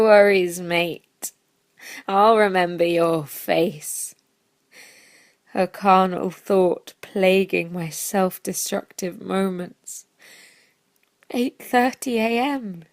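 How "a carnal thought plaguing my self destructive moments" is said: usual speaking voice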